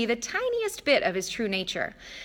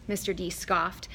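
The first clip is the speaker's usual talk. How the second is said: plain reading voice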